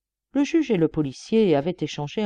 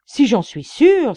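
loud, slow, outraged exclamation; indignant outcry; 6 semitones higher, 10 dB louder, slower than usual. A narrator's reading. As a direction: cry it out, stung by the doubt. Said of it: "s'écria-t-il". (s'écrier avec indignation)

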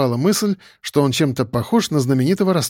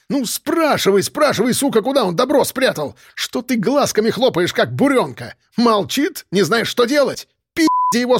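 much higher furious tirade; furious yelling; 8 semitones higher than usual. A narrator's reading. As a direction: rip into the idiot at full pitch. Boss screaming at officer.

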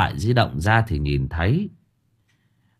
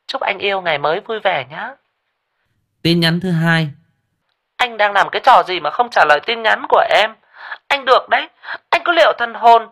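much higher, sweet then threatening woman's voice; coo, then turn menacing; girlfriend's voice; 11 semitones higher, 4 dB louder than usual. A narrator's reading. (giọng nữ cao, ngọt rồi đe dọa)

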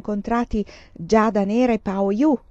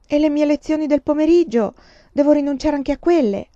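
put-on higher, louder anxious girl; dismayed daughter; 8 semitones higher, 7 dB louder than usual. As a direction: ask it in alarm. Daughter objects.

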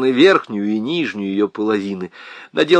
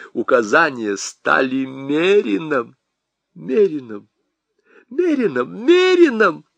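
rising hysteria; 3 semitones higher than usual. Acted. Husband repeats the word, rising into hysteria. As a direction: build each repeat into hysteria.

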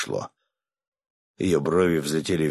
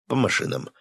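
commanding; 7 semitones higher than usual. Acted. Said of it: "скомандовал я".

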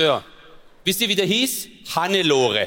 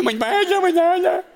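mock wailing; 12 semitones higher, 3 dB louder, slower than usual. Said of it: "Ich so".

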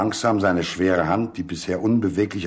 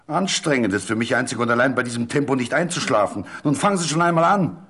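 higher and louder, impatient and coarse; heated, rough tone; 5 semitones higher, 3 dB louder than usual. A narrator's reading. Doktor B. rudely urges Centovic to start, impatiently. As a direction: snap at him to get on with it. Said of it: "in heftigem, beinahe grobem Ton".

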